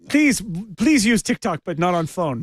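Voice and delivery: put-on high, slow, pleading Russian accent. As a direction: beg in a hammy Russian accent. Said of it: "We're all doing bad Russian accent".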